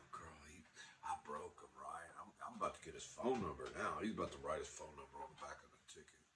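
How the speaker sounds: soft, fast private scheming; whisper-rush your hopeful plan to yourself; hushed inner thought. Retold or imitated. And I'm thinking.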